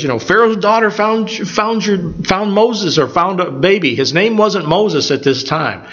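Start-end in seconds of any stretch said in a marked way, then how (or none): 0.2-3.9 breathless news. Retold or imitated. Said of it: "she goes and says".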